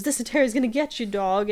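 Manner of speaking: fast, high, loud taunt; excited taunt. Retold or imitated. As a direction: rush the taunt out gleefully. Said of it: "he goes".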